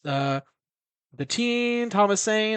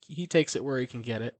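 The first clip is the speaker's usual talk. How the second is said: normal talking voice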